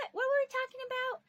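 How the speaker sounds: mock her as a confused child; grandma lost in her own chatter, imitated high; imitating grandma. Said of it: "And she goes".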